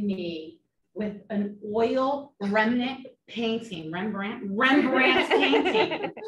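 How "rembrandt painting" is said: laughing. Real, not acted. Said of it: "laughing at own slip".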